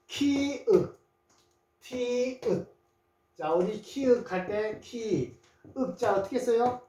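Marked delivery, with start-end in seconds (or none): 0.1-2.7 키읔 and 티읕 with long, high first syllables; sounded out. Shown as a demonstration. Teacher breaking down the two letters, sounded out. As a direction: hold 키 and 티, then add 읔 and 읕. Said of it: "키읔, 티읕 볼까요".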